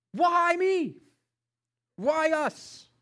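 high, shouted protest at God; loud pleading. Retold or imitated. Husband asking God about his sick wife, loud re-enacted cry. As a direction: cry out loudly, re-enacting your own complaint. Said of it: "I asked God loudly".

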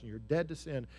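quiet tender affirmation; gentle Holy Spirit. Acted. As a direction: tell them quietly they are free. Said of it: "the Holy Spirit comes and he says".

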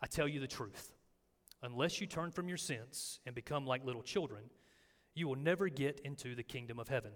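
soft, measured reading of Jesus' words; quiet scripture reading in a sermon. Acted. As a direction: read it gently, letting each phrase land.

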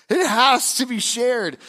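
"has" stressed, high and slow; emphasis on has. Shown as a demonstration. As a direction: land on "has to be shared". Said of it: "That's the difference".

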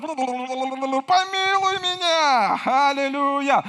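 high, loud crying shout for rescue; desperate cry. Acted. Drowning man screaming to Jesus, a high loud desperate cry. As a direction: scream for help like you're going under. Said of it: "Когда кто-то тонет".

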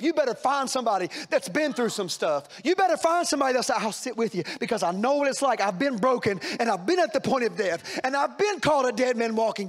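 preaching at full force. Acted. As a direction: lift it into a shouted sermon.

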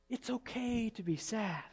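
soft, slow and tender; gentle reassurance. Real, not acted. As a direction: let it sink in quietly. Same reassurance repeated gently.

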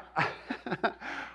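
short airy chuckle under the breath; a quiet laugh to yourself; soft amused breath of a laugh; soft breathy laugh. Real, not acted. Pastor laughs at congregation.

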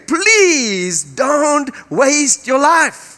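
very slow, high, pleading; urgent plea. Acted. Preacher urging the congregation, slow and high. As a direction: beg them not to waste it.

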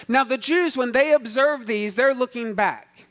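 rising preacher's fervor; preaching. Acted. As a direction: lift it and preach. Preaching rise.

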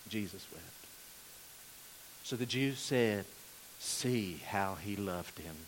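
hushed reverence. Acted. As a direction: let the verse sink in quietly. Hushed reading of Jesus wept.

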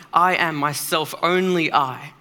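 slow, drawn-out "I am myself, only I." with weight on "I"; solemn stress on "I" and "only I"; emphatic. Shown as a demonstration. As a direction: lean hard on "I" in "I am myself, only I.". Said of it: "an emphatic way of saying".

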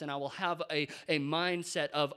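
ordinary delivery

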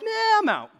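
high, loud dismissive nah; flat refusal. Retold or imitated. Preacher mocking his answer to God's call, high and loud. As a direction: bail out casually. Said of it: "I'm like".

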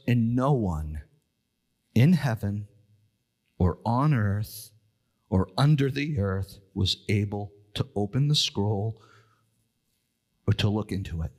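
low, measured Bible reading; scripture reading. Acted. Reads scripture verse.

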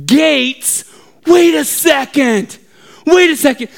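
high-pitched, dawning astonishment; sudden realization. Retold or imitated. Preacher reading suddenly understands the verse, astonished. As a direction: stop short at the discovery.

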